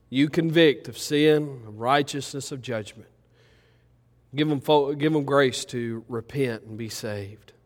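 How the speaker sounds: ciche, powolne wołanie do Boga; módl się z pokorą, coraz ciszej; modlitwa kaznodziei. Acted. Pastor prays over congregation.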